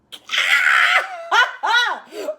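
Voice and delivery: high, loud burst of laughter. Real, not acted. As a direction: laugh out loud at your own silliness. Laughing at her own tantrum.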